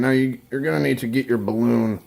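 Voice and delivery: usual speaking voice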